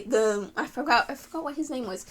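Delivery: ordinary talk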